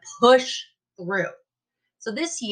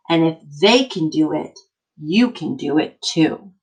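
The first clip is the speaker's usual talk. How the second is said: put-on slow, emphatic read-aloud for kids; reading to children; 2 semitones lower, 3 dB louder than usual. Teacher reading an encouraging book to her class, emphatic. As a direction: read it like a rousing picture book.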